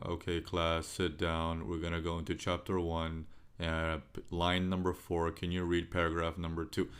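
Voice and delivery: low, droning, lifeless teacher voice; dull monotone teacher. Retold or imitated. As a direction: drone on like a boring teacher. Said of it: "he's just talking like this all day long".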